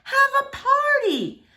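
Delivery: high, slow, eager announcement; eager suggestion. Acted. Girl proposing a party to Gloria, eager and drawn out. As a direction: draw it out like a big idea.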